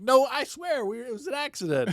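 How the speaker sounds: a very high, squeaky hammed-up denial; mock pleading. A caught soap lover pleading innocence, high and squeaky. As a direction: squeal out a panicked excuse.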